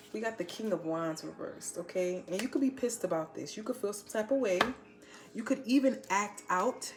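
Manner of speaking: ordinary delivery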